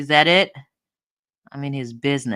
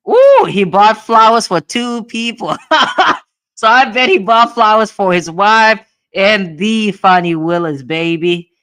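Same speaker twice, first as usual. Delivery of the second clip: loud, high, laughing through words; 6 semitones higher, 13 dB louder than usual. Real, not acted. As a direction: giggle out the gossip. Laughing over the flower purchase.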